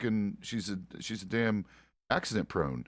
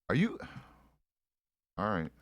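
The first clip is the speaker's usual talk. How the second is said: ordinary talk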